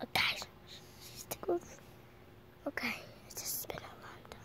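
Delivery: soft tired whisper; barely voiced, drowsy.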